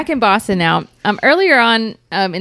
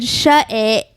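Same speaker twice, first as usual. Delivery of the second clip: Australian accent; 3 semitones higher than usual. Shown as a demonstration. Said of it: "I said, I said".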